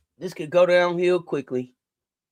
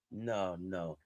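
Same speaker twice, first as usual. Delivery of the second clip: ordinary talk, nothing marked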